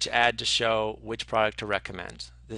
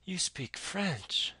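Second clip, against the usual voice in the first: slow, high, softer amazed question; dumbfounded; 7 semitones higher, 5 dB quieter, much slower than usual. Retold or imitated. Date amazed that Bill Murray speaks French, slow and high. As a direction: let the surprise slow you down. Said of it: "she's dumbfounded".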